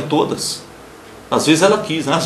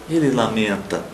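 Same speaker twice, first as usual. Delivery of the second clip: usual speaking voice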